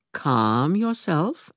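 slow, firm calming; steady her down, slow. A narrator's reading. Aunt Lydia calms the panicked aunt, slow.

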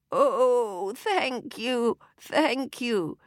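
put-on high, quavering old lady; wobbly frail old woman voice. A narrator's reading. Fairy disguised as old lady, quavering and high.

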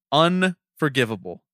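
Unforgivable spelled out slowly with weight; slow down and hammer Unforgivable; slow repeated emphasis. Real, not acted.

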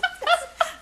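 high shrieking laugh; snorting laughs. Real, not acted. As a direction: burst out laughing. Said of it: "did I just snort".

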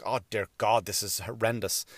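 low, soft mock horror under the breath; quietly appalled aside, pitched low; muted dismay. Reacting in dismay to the story.

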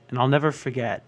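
ordinary delivery

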